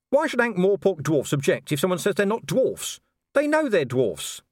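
puzzled, indignant copper; indignant Vimes. Acted. Vimes questioning Cheery about dwarfs, indignant.

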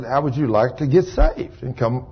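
hushed, tender appeal; gentle invitation. Retold or imitated. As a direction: quietly offer him salvation. God invites Isaiah to be saved.

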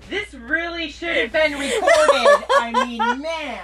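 real helpless laughter; wheezing with laughter while talking; laughing. Real, not acted. Laughing fit over funny memory.

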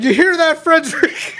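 father teasing his son about the new name, laughing; mock teasing. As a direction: tease the boy while laughing.

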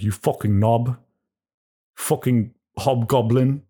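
a light mock tirade from a celebrity chef. Comic cook insulting a waiter, light mock chef rant. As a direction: sneer it like a hot-tempered chef, lightly voiced.

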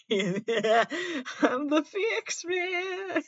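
high, drawn-out squeal through laughter; laughing hero impression. Podcaster mock-plays the book's hero, laughing and squealing. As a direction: stretch it out slowly, high and giggling.

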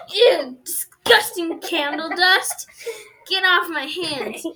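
loud, slow, squealing disgust; mock disgusted girl. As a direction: act grossed out by the dust. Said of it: "she's like".

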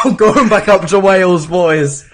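loud, high cheery send-off; hammed-up farewell to the lads; mock British Jesus. Friend voicing Jesus leaving for Wales, loud and mocking.

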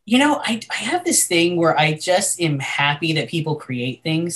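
ordinary talk

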